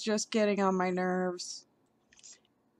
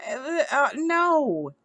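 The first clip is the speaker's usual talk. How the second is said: exasperated no; 5 semitones higher, slower than usual. Real, not acted. Reacting to the rabbi's claim, an exasperated no. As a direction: sputter out a fed-up no. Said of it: "I'm just fired up and pissed".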